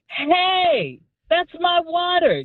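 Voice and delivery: high call after him down the street. Retold or imitated. Woman calling after the water thief, high cry. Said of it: "I cried out".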